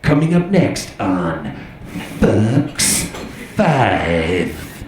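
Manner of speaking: low, slow network announcer voice; mock Fox announcer. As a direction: drop low for a dramatic channel promo. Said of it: "whenever the announcer says the name".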